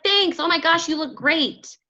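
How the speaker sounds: mock gushing friend; gushing thanks. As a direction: beam back at them. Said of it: "They're like".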